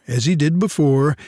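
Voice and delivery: plain reading voice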